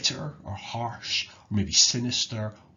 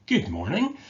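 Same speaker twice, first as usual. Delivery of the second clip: surprised disappointment; 8 semitones higher than usual. Shown as a demonstration. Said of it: "disappointment or, or surprise".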